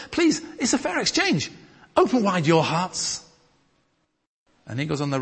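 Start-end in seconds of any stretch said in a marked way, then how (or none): 0.1-3.2 rising higher and higher, begging; Paul begging the church to open their hearts; pleading. Acted.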